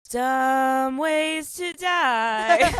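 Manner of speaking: hammed-up husband, slow and emphatic; husband's deadpan quip. Husband mocking her dramatic exit, slow and loud. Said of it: "my husband goes".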